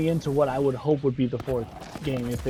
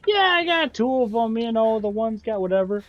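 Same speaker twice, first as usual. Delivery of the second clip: higher-pitched mock character voice, tossed off; breezy pretend dad answer, pitched up; imitated offhand reply; 6 semitones higher than usual. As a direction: put on a higher voice and shrug it off. Voicing Typhon's imagined casual answer.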